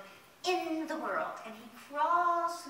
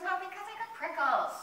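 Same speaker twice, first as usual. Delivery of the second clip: higher, whiny pretend upset; mock crying; 4 semitones higher, 7 dB louder than usual. Prickly rose explains the tears to the fairy, mock crying. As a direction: complain like a tearful storybook character. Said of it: "Why are you crying?".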